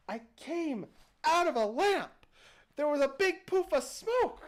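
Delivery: exasperated genie insisting he is real; genie beside himself. Acted. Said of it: "beside himself".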